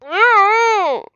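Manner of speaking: loud, high, drawn-out muffled no; mock muffled shout; loud protesting no. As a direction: protest through a hand over the mouth. [name] protests being silenced.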